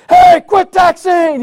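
high, loud, slow shout; yell out window. Retold or imitated. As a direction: bark it at a driver through the car window. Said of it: "He yells out the window".

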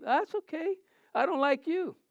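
high, loud preacher's cry; fiery preaching. Acted. Pastor preaching love to his church, loud and high. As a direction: preach it out to the crowd.

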